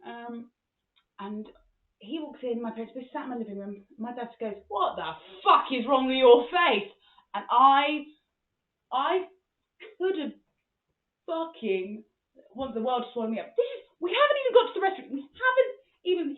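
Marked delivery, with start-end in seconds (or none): 4.7-6.9 loud, slow, blunt outburst; appalled, stretched-out exclamation; appalled dad. Retold or imitated. Dad's blunt reaction, acted. 13.6-16.4 high, loud, fretful protest; mortified protest. Retold or imitated. Mortified inner protest, acted.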